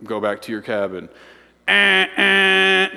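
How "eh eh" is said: two held air-horn blasts; air horn honks. Retold or imitated. Camp counselor demonstrating the breakfast signal, imitated air horn. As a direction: imitate an air horn sounding twice on one note. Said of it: "Two honks mean it's time for breakfast".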